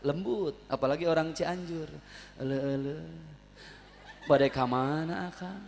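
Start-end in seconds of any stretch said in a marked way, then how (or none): 2.4-5.6 gentle Sundanese. Shown as a demonstration. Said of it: "Orang Sunda kalem, lembut".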